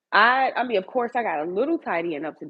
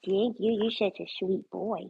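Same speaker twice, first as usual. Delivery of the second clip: usual speaking voice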